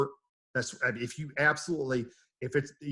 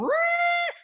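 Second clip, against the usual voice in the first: very high, loud whistling squeal; whistling rails; 6 dB louder than usual. Retold or imitated. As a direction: imitate the railing shrieking in the wind. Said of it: "The rails are going".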